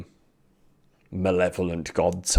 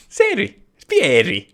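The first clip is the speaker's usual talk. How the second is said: laughing; 14 semitones higher, 10 dB louder than usual. Pretend. Mimicking the clip, laughing.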